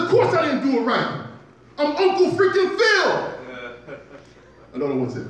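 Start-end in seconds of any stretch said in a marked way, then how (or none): 0.0-4.0 indignant loud retort. Retold or imitated. Indignant reply to critics.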